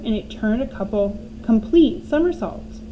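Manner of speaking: ordinary delivery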